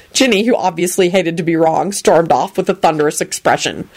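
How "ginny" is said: plain reading voice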